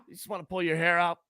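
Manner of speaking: ordinary talk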